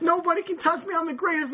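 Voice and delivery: high-pitched boxer boasting. Retold or imitated. Retelling a post-fight interview speech to a co-host, imitated high boxer voice. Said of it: "[name], that's the greatest speech".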